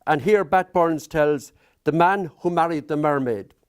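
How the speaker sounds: ordinary delivery, nothing marked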